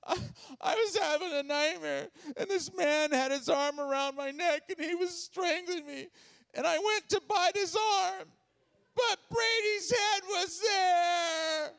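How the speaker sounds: high tearful wail building to a bawl; hammed-up crying, ending in a long shout; sobbing explanation. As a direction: wail it out like a hysterical wife. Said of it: "she catches her breath. She says".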